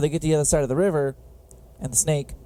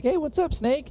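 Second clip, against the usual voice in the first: higher, friendly otter greeting; cheerful otter voice; 13 semitones higher than usual. Retold or imitated. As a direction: voice the otter chirpily greeting the snake. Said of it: "he's like".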